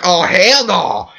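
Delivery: slow, drawn-out outraged protest; mock outrage. Reacting to Victoria's demand, a slow outraged refusal. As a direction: refuse it, dragging it out. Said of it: "I was like".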